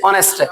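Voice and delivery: ऑनेस्ट opening on the vowel; no H heard in ऑनेस्ट; real pronunciation. Shown as a demonstration. Teacher drilling the class, ऑनेस्ट with silent H. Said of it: "real में इसको पढ़ा जाता है".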